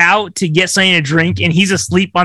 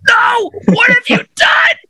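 high crying shout; husband sees the table sawn in half; anguished shout. Acted. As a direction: wail it in despair. Husband finds table cut.

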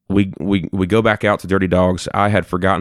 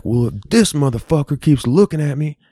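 put-on higher, aggressive drunk mutter; quoting the drunk guy; 7 semitones higher than usual. Drunk stranger complaining about being stared at, higher mock aggression. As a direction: pitch it up as the belligerent drunk. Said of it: "He's like".

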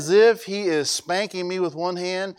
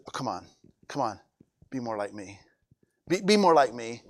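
low, much softer coaxing; gentle urging; 7 semitones lower, 6 dB quieter than usual. Acted. Jesus softly coaxing a struggling believer, low and gentle. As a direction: coax him gently along.

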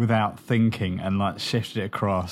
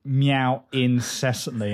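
normal talking voice

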